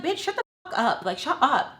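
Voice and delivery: high, exasperated retold outburst; worked-up, high-pitched telling-off; mad. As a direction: snap it as if fed up with her. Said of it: "I'm just so mad at her".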